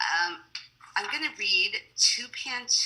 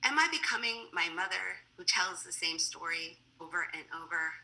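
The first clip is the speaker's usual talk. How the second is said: quieter, measured poetry recital; poem reading; 7 dB quieter than usual. Acted. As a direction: recite softly, line by line, endings dropping away. Reads a poem about mother.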